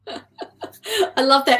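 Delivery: slow, laughing through the words; delighted laugh at the guest's dream. Real, not acted. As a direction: chuckle warmly as you say it. Host laughs at guest's answer.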